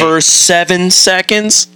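milk the punchline slowly; exaggerated emphasis. Real, not acted. Stressing absurd duration.

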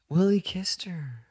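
hushed scandalized whisper. Real, not acted. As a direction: whisper it in shock. Whispered after gasp.

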